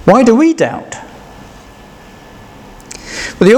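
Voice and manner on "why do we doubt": ordinary talk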